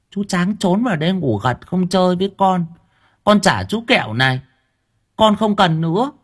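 put-on a sulky little kid handing back the lollipop; naive little boy. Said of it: "Thằng cu Tẹo ngây ngô".

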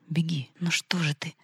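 tense whisper to himself; anxious whisper. Acted. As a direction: urge her on under your breath. Alyosha at window, in horror. (испуганный шёпот)

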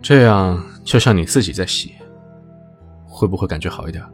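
gentle deep voice; tender. A narrator's reading. 061 voiced low, smiling.

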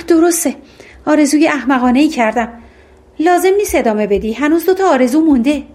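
usual speaking voice

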